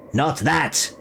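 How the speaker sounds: sharp high cry of alarm; shriek. A narrator's reading. Crow shrieks at Bran to forget the golden face, high shriek. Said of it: "it shrieked at him".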